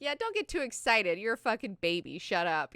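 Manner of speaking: mock scolding. Bene Gesserit scolding.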